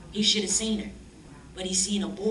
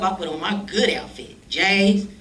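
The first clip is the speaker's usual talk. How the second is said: usual speaking voice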